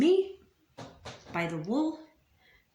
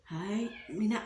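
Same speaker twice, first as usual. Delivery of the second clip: ordinary talk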